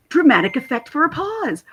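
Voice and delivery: ordinary delivery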